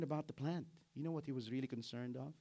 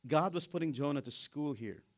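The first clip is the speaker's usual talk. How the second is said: ordinary delivery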